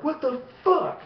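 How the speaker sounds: high, drawn-out mock outburst; retold outburst. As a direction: act out a slow, high-pitched exclamation. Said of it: "You know, like that".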